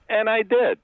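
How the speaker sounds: proud punchline. Retold or imitated. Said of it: "And he said".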